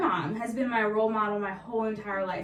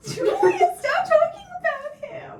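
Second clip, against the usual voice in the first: laughing protest; 8 dB louder, slower than usual. Real, not acted. Embarrassed, laughing protest at co-host.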